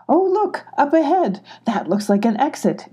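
high, excited discovery, easing off; excited cheer. A narrator's reading. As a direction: cheer at spotting a way out, pitch jumping up. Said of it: "Ginny cheered".

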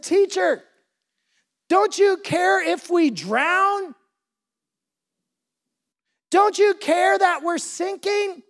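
high, slow, stretched-out pleading; preacher acting a desperate plea; freaking out. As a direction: cry out to the teacher like a drowning disciple. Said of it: "they start freaking out".

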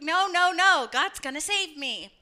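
high brush-off, easing down; confident refusal. Acted. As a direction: turn down help with acted certainty. Voicing man refusing second rescue.

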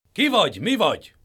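high, loud challenging guard; stern guard. A narrator's reading. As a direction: halt the stranger. Sun guards halt the traveller.

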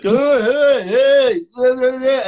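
high, loud mock heckling; mimicking onlookers. Friend imitates people staring at her, high mocking calls. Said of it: "there's like".

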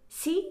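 し？ rising sharply, overdone; し？ pushed way up at the end. Shown as a demonstration. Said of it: "ちょっと今のやりすぎたかも".

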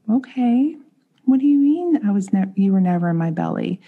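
a mother thrown by a strange remark, asking it back; startled disbelief. Retold or imitated.

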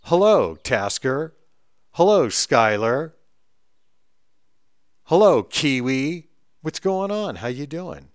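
answering every caller with hammed-up warmth; cheery phone greetings. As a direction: stretch each hello like greeting old friends.

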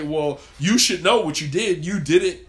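wife snapping you should know; imitating an upset wife. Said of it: "women will say".

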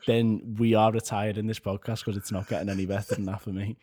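normal talking voice

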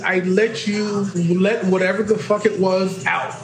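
usual speaking voice

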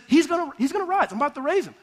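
high, loud retold assurance; animated, insistent retelling of Jesus' answer; emphatic promise. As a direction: announce it urgently, pitched well up.

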